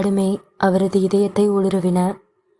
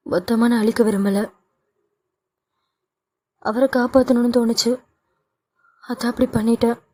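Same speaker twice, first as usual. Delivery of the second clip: put-on higher, mildly tearful young woman; pleading daughter; 3 semitones higher than usual. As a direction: plead with mother, a touch of tears. Daughter questioning, upset.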